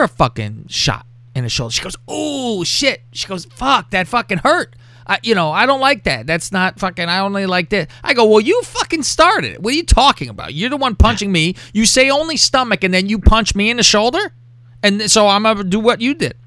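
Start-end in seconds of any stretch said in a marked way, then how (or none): 2.1-4.8 retold pained yelp. Retold reaction to being punched. 8.2-16.3 high-pitched retold indignation; indignant protest. Man protesting to his sparring partner that she started it, indignant.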